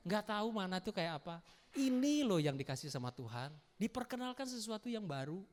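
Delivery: ordinary delivery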